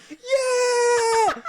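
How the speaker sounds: high, loud cheering shout. Retold or imitated. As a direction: cheer them on. Cheering the couple.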